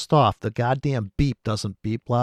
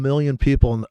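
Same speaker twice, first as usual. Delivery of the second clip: usual speaking voice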